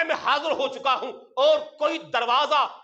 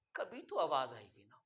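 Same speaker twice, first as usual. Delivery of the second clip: much softer, low, rushed; choked hope; 12 semitones lower, 14 dB quieter, faster than usual. Retold or imitated. Old pilgrim hoping aloud, choked and soft. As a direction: whisper the hope through tears. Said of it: "رو رہا ہے".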